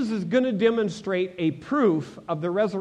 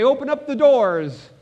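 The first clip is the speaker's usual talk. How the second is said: loud, high mock command; calling out; 7 semitones higher, 9 dB louder than usual. Preacher imagines ordering a grave opened, calling out loudly. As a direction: call it out across a graveyard.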